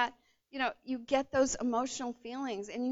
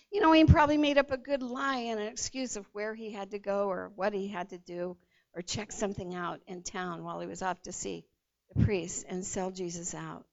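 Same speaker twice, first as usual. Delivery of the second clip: ordinary delivery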